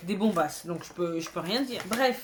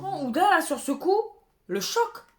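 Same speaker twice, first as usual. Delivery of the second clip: high, mock shocked at Huda; 7 semitones higher than usual. Real, not acted. Telling viewers about the Huda blush, high mock shock. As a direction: gasp it out, scandalised.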